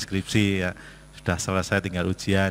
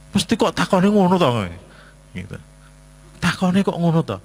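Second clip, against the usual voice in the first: put-on whiny complaining child; 7 semitones higher, 4 dB louder than usual. Child complaining about father's question.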